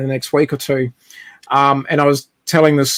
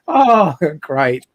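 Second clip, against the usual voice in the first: sarcastic groan; slower than usual. Retold or imitated. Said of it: "you get the whiff and you go".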